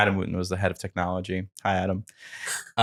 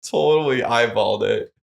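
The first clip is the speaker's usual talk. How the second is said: ordinary talk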